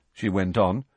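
ordinary delivery

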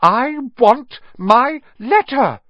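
slow, high, loud demand; shouting. A narrator's reading. Boy refuses to move, shouting. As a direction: shout it word by word. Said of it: "he shouted".

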